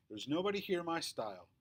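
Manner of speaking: ordinary delivery, nothing marked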